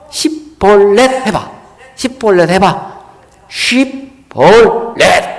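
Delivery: 시볼렛 stretched very slowly, each syllable clear; Shibboleth test. Retold or imitated. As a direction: demand they say 시볼렛 and drag it out. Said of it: "이 발음을 못해".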